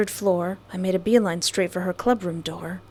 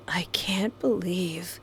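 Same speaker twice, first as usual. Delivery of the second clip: breathless whispered disbelief; 2 semitones lower, 8 dB quieter, slower than usual. Real, not acted. Stunned player whispering over the scene, soft and breathless. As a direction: barely breathe the words. Hushed shock.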